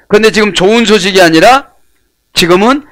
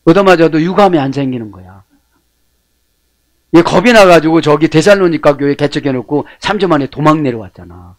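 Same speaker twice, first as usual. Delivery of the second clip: normal talking voice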